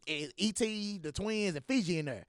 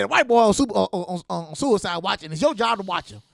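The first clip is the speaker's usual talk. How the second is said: loud insistent order; 3 semitones higher, 8 dB louder than usual. Retold or imitated.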